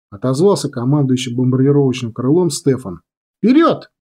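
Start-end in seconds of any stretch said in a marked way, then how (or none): 3.4-3.9 high-pitched battle cry; charge command. A narrator's reading. Commander launching the bombers, rousing call. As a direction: send the wing forward with a shout. Order to start the attack.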